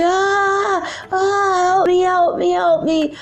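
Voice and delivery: high, wailing mock cries for help; imitated panicked screaming. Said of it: "then he started screaming".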